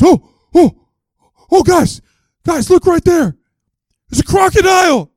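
high, loud alarmed shouting; mock-alarmed counselor. Camp counselor pointing out a crocodile to boys, loud mock alarm. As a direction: shout it high, as if spotting danger. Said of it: "the counselor went like this".

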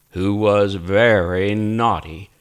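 plain reading voice

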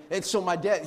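ordinary talk

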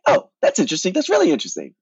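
high, light impression of Bart's politeness; hammed-up polite interest, pitched high; mock polite interest. [name] mimicking Bart's lukewarm reply, high and light. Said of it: "He was like".

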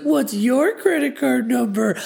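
put-on high, slow toddler voice echoing him; baby talk. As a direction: parrot him like a little child. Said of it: "in baby talk".

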